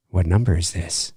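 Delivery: low, soft, quietly menacing; almost normal, sinister. Retold or imitated. Host imitating Ghostface on the phone, low and quietly sinister. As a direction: say it flat and calm with a hidden threat. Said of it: "not sinister, sinister".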